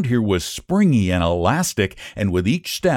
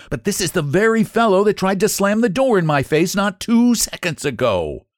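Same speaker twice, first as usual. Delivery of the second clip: high, rising protest that drops at the end; a cross boy making his accusation; mildly angry, raised-pitch complaint; indignant; 8 semitones higher than usual. A narrator's reading. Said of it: "grew angry again".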